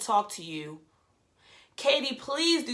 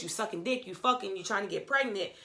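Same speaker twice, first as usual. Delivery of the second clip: usual speaking voice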